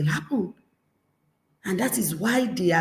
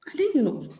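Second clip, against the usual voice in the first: mocking mock-surprise, raised and soft; sarcastic child; 6 semitones higher, 4 dB quieter than usual. Child mocking a parent's declaration, sarcastic. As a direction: feign surprise to poke fun. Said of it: "child mocking the parent".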